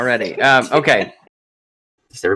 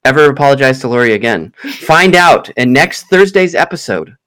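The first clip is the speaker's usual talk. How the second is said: hammed-up announcer voice building suspense; dramatic show teaser; 4 dB louder than usual. Host teasing listeners about the feud, announcer style. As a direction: tease the feud like a cliffhanger promo.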